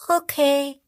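a small obedient peep; piping. Acted. Little crocodile agrees with the big ones, high peep. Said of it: "piept das kleine Krokodil".

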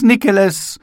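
high, loud "Nikeles" falling at the end; eager boys' cry; calling again. A narrator's reading. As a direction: schoolboys pressing to the glass.

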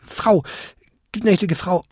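high, hesitant little goblin; stammering apology. A narrator's reading. Goblin begs the old lady's pardon, stammering. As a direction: grope for the proper address, small and high. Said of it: "stammelte der kleinste Winterkobold".

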